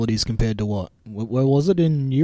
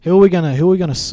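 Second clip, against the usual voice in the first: excited; 6 semitones higher, 7 dB louder, much faster than usual. Real, not acted. Mock outrage, who to slam.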